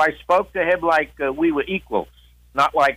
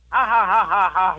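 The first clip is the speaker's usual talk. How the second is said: imitated laugh; 5 semitones higher than usual. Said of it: "So he laughed".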